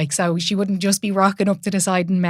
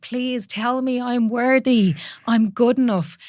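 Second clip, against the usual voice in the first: higher, slow, desperate plea for approval; pleading inner voice; 5 semitones higher, slower than usual. Retold or imitated. Retelling her inner voice to the host, slow pleading. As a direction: beg slowly, voice lifted and strained. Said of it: "because I was like".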